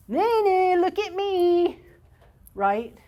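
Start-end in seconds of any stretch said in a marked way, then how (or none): 0.1-1.8 high, loud mock child whine; whiny attention-seeking kid. Parent imitating a misbehaving child, high loud whine. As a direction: play the kid begging for a look. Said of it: "by going".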